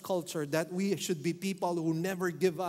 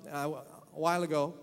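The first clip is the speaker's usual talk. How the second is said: usual speaking voice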